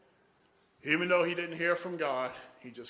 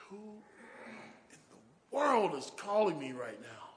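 groggy annoyance; 3 semitones higher, 5 dB quieter than usual. Retold or imitated. Man woken by a late-night call, groggy annoyance. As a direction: whisper the first word, then grumble through it.

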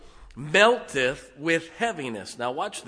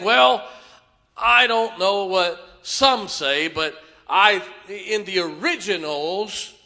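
cocky know-it-all; 5 semitones higher, 7 dB louder, slower than usual. Retold or imitated. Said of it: "Cocky's when you start saying".

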